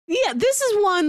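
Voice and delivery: usual speaking voice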